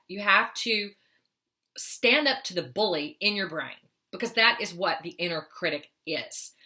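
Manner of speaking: ordinary talk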